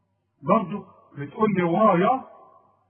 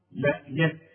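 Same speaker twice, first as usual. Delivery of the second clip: ordinary talk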